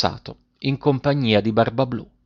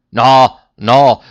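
two loud, separate blasts at normal pitch; shouted refusal; 7 dB louder than usual. A narrator's reading. Bluebeard refusing his pleading wife, shouting. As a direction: punch each word out loudly. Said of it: "gridò egli".

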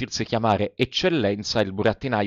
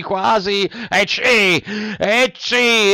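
higher and higher sneeze, bursting loud at the end; building sneeze; 9 semitones higher, 4 dB louder, much slower than usual. A narrator's reading. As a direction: wind up into a big sneeze. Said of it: "fece altri due starnuti".

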